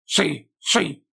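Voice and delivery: voce gorgogliante e acuta, impaziente; un gorgoglio orrendo da skaven che incalza; gurgling voice. A narrator's reading. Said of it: "con la sua voce orrendamente gorgogliante". (voce orrendamente gorgogliante, acuta)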